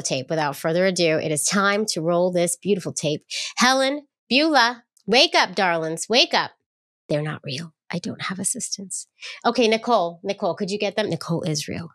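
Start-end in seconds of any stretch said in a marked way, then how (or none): 3.6-6.5 mock summons to sleepy helpers; playful calling out. Calls to rouse imaginary assistants. 7.1-9.1 dropped, much softer voice; hushed admission; confiding aside. Real, not acted. Confiding aside after the pretend call.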